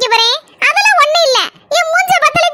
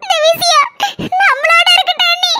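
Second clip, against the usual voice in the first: ordinary talk, nothing marked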